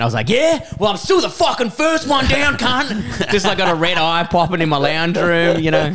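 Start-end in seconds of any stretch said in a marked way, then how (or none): 0.3-3.3 higher, heated comeback, imitating himself; mock angry retort. Snapping back at his mate over the hill, mock angry. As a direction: fire back like a riled-up rider. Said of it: "then you got to angry [name]".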